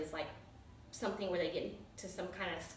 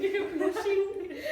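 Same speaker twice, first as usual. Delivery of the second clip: laughing; 12 semitones higher, 6 dB louder, much slower than usual. Real, not acted. Teasing cohost, laughing.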